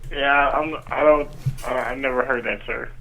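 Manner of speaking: ordinary talk, nothing marked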